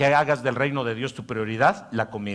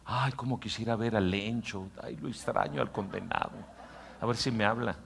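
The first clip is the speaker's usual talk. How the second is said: softer, wistful inner voice; inner voice longing; 7 dB quieter than usual. Acted. Pastor voicing the inner man missing Lencho, soft and wistful. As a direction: sigh it as a secret longing. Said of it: "escuchado a su hombre interior".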